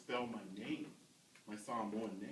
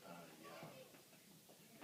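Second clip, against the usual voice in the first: reluctant admission; 10 semitones lower, 18 dB quieter than usual. Retold or imitated. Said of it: "So I was like".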